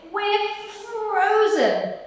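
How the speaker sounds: a second rabbit, high and drawn out; frozen rabbit. Acted. Other rabbit complaining of cold, high and slow. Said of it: "said the other".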